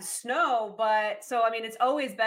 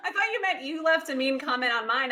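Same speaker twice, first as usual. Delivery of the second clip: usual speaking voice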